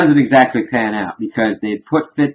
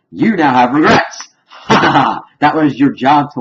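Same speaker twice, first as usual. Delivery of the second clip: higher, louder gloating with a laugh; mocking gloat; 5 semitones higher, 7 dB louder than usual. Villain taunting her about her new regrets, gloating. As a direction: taunt him loudly, laughing in his face. Villain mocked in retold threat scene.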